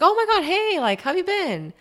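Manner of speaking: high, bright-voiced hey; mock friendly greeting. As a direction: act out a gushing hello. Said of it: "So I was like".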